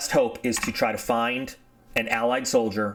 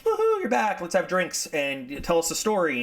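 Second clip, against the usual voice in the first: cheering welcome; 6 semitones higher than usual. Retold or imitated. Squadron greets returned pilot.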